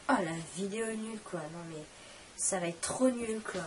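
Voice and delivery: normal talking voice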